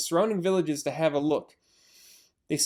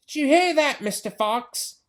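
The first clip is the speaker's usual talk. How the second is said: taunting yell; 9 semitones higher, 4 dB louder than usual. Acted. Bean shouting down the hole, taunting yell. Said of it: "yelled Bean".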